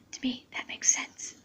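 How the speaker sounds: soft unvoiced whisper. Real, not acted. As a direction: keep whispering the check to yourself. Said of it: "I'm gonna whisper mine".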